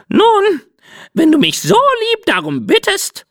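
very high, preening parrot voice; a flattered parrot graciously giving in; coy parrot. Acted. Parrot Cinzia relenting because he asked nicely, high coy squawk.

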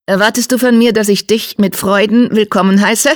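high, pointed, faintly hostile question; Geißel. A narrator's reading. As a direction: challenge him in a high, sharp voice. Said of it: "Unter Geißels Stimme lag ein kaum hörbares Fauchen".

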